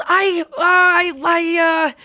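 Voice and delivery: high, loud, stuck on one word; flustered stammer. Retold or imitated. Woman confronted by her ex, imitated flustered stammering. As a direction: imitate a mortified loss for words. Retold speechless stammer to Ross.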